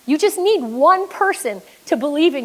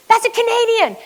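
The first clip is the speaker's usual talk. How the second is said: very high exclamation, falling at the end; excited announcement; 10 semitones higher than usual. Retold or imitated. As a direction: act out a bystander announcing a discovery. Bystander announces it to all.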